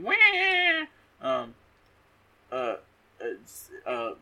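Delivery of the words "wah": very high mock whinny; imitated horse whinny. Horses panicking at gunshots outside, imitated as a high whinny. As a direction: do a silly horse neigh. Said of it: "horses going".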